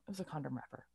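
retold secret in a small, low voice; hushed confession. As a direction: admit it quietly, just between the two of you. Hushed answer to husband.

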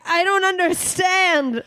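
high, slow, baffled voice; confused guy's brain. Pretend. Friend voices men's brains confused by her, high and slow. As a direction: play a bewildered brain. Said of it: "their brain's like".

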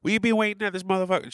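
complaining wife. Retold or imitated. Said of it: "on the phone straight away complaining".

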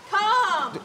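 one high, loud "Calm"; exasperated repeat. Acted. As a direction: call the word out impatiently. Wife repeats word to husband.

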